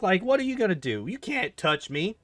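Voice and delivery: high, scornful mock of the game's villains; mock villain taunt. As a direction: puff up and sneer like an arrogant villain. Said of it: "these guys are just like".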